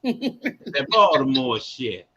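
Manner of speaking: that Baltimore shit stretched out very slow; slow, relishing Baltimore drawl; drawn-out Baltimore phrase. Shown as a demonstration. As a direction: savour every word of that Baltimore shit. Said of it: "That's what they call that Baltimore shit".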